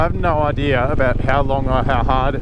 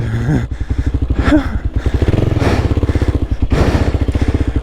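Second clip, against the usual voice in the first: breathless laugh; 3 semitones lower than usual. Real, not acted. Bogged in sand.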